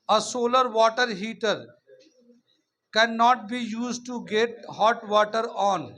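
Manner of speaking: very slow, loud, word by word; slow dictation. Shown as a demonstration. Teacher dictating an exercise question to the class, slow and loud dictation. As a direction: spell each word out slowly for the class to write down. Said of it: "वो मैं orally बोल देता हूँ".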